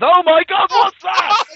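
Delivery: a high, loud gasp of alarm; hammed-up fright at the sight of a cheap monster; mock shock. Acted shock at the rat.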